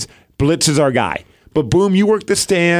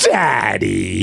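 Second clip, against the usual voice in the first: put-on low, drawn-out kid's reproach; mock-disapproving little girl; imitating scolding daughter; 5 semitones lower than usual. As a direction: draw it out like a disappointed child. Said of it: "it's always the".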